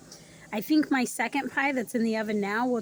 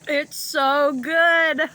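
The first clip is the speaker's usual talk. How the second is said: slow, high, loud and drawn-out; rapturous; 6 semitones higher, 9 dB louder, much slower than usual. Real, not acted. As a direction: savor every word in bliss. Raving about the pie.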